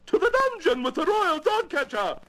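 high, screeching royal command; screamed order. Acted. Queen banishes the royal dog catcher, screaming. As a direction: scream out the sentence like an outraged Queen. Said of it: "screamed the".

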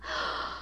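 soft breath in; wondering gasp. A narrator's reading. Benji looks at the sleeping world, wondering gasp.

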